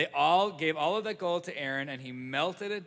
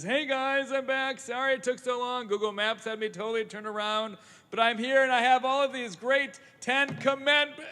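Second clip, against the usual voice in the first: higher and louder, bouncy homecoming; cheerful Moses; 8 semitones higher, 5 dB louder than usual. Acted. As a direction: burst in like you're thrilled to be back.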